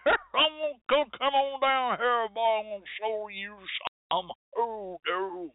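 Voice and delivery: exaggerated country accent. Speaker mocks a swamp hoodoo teacher luring newcomers, thick country accent. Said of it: "they have an accent like this".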